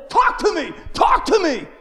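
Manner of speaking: loud, high-pitched desperate demand; crazy-guy shouting. Retold or imitated. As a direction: yell at God like a street ranter. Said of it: "I'm like one of these crazy guys".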